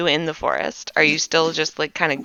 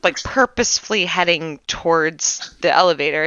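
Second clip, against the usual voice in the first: usual speaking voice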